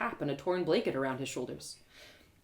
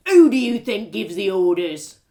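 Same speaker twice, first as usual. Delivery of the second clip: much louder, slow bullying guard; angry guard; 12 dB louder than usual. Acted. Guard pulls rank on the questioning lady, bullying. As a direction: bark at her like a bully guard.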